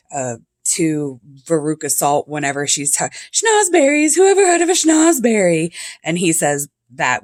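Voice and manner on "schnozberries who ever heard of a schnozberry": much higher, louder mock-indignant Willy Wonka voice; Gene Wilder impression. Willy Wonka mocking Veruca Salt, high and indignant. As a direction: scoff at the word like a fussy candy maker. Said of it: "Gene Wilder says it".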